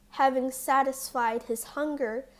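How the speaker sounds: usual speaking voice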